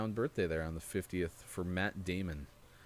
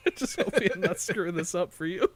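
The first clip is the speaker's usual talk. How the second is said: higher and louder, laughing through it; 10 semitones higher, 8 dB louder than usual. Real, not acted. Teasing his co-host about a spoiler, laughing. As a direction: giggle the words out.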